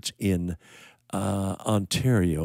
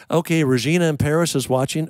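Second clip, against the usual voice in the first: excited shout-out; 8 semitones higher, 6 dB louder than usual. Real, not acted. Excited live shout-out to viewer.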